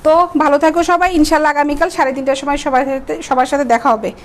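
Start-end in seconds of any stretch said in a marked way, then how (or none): none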